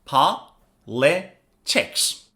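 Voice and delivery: very slow, split into three separate syllables; "politics" broken apart with long pauses between syllables. Shown as a demonstration. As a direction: say "politics" in three evenly spaced beats. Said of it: "this word has three syllables".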